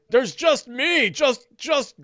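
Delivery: high, slow, halting ogre voice; frightened ogre. Acted. Cornered ogre answering his attacker, high frightened voice. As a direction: plead slowly, stumbling over the words. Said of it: "oafish viciousness to fear".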